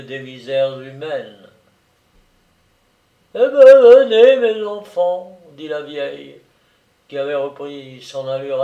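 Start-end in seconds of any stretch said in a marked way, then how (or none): 3.3-5.4 put-on old woman calling.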